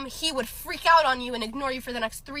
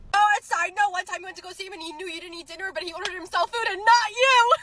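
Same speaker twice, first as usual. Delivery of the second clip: much higher, half laughing, half crying; outraged laughing rant; 9 semitones higher, 6 dB louder than usual. Real, not acted. Girl venting outrage about her ex's selfishness. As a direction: pour out the outrage.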